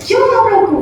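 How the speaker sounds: very high, loud startled question; mother shocked at her child's demand, very high. Acted. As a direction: jolt up in disbelief.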